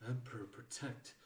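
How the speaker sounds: ordinary delivery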